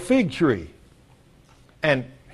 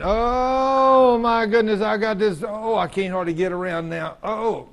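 long, high groaned-out complaint; complaining groaner; 5 semitones higher, slower than usual. Acted. Churchgoer waking up one morning stiff, groaning and complaining. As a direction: moan it out, drawn out and achy. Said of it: "Do you hear people talk like that?".

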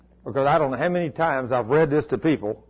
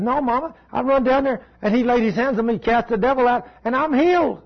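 put-on high, raised child's voice, defiant; little boy refusing; 9 semitones higher than usual. Preacher voicing Cody refusing his mother, high and firm. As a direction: plead firmly like a six-year-old.

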